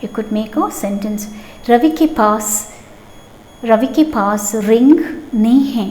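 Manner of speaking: ordinary delivery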